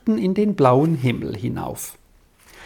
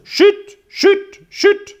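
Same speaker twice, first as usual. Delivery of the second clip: very high, short, loud bird cries; rain bird call; 5 dB louder, much slower than usual. A narrator's reading. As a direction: pipe each word high like a bird. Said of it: "Jetzt merkt er den Regenwind und ruft". (sehr hoher, kurzer Regenvogelruf)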